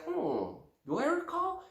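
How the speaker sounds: high, soft thoughtful hmm; musing recall. Retold or imitated. Listener on the phone, softly musing. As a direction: wonder aloud softly. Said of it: "on the other side will be like".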